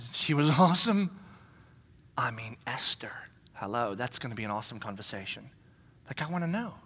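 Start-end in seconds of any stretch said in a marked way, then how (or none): 2.2-5.5 soft breathy aside; excited whisper. Real, not acted. Preacher confiding in a whisper about meeting Esther in heaven. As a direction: whisper it like a secret.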